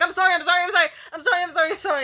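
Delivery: high, loud rapid sorries broken by laughter; laughing apology. Retold or imitated. Boyfriend who chopped her throat, apologizing over and over while laughing. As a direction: gush apologies while cracking up.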